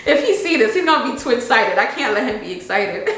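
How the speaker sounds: laughing through happy tears; high, loud, tearful and giggly; tears of joy. Real, not acted. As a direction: let joy spill over while crying. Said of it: "It's like tears of joy".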